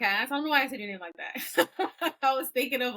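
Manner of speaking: laughing through the words; amused laughing. Real, not acted. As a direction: break into a giggle while explaining. Laughs at own odd pronunciation.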